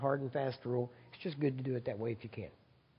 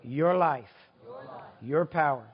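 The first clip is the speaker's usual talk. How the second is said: leading prayer. Real, not acted. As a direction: pray it out with conviction.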